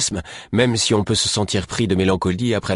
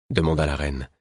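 ordinary delivery

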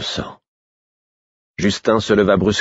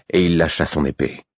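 plain reading voice

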